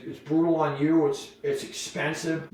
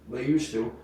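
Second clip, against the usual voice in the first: ordinary talk, nothing marked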